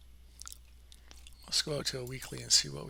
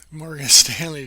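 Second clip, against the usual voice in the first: louder retry breaking into a laugh; laughing at own slip; 15 dB louder than usual. Real, not acted. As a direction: laugh through the stumble on the name. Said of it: "my lips don't work".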